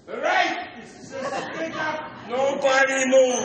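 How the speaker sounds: very high, silly hold-up voice; idiot bank robber. Acted. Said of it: "my impression of an idiot trying to rob a bank".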